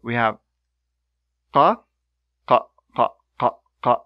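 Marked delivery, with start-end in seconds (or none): 1.5-4.0 one clean uvular burst on "qa"; Arabic qaf. Shown as a demonstration. Teacher drilling the qaf plosive for learners. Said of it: "This is just one time. Plosive".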